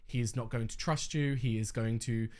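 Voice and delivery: normal talking voice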